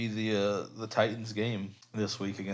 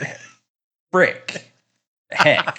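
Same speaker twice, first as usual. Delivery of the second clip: loud, higher, frustrated yell; angry outburst; 7 semitones higher, 10 dB louder, much slower than usual. Real, not acted. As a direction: blurt it out furious, censoring yourself. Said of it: "He's mad".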